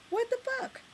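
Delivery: very high, disbelieving cry; shocked exclamation. Real, not acted. Vlogger finding the soul food spot gone, high shocked cry. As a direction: yelp of disbelief.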